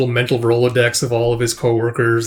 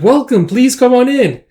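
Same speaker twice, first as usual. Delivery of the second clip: high, slow, louder greeting; effusive welcome; 11 semitones higher, 5 dB louder than usual. Acted. Host greeting guest at the door, effusive. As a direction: welcome them with acted enthusiasm.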